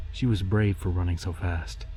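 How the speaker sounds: ordinary delivery, nothing marked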